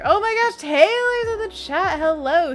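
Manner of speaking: excited greeting. Real, not acted. Streamer spots a friend in the chat, excited. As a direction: squeal a happy hello at a surprise arrival.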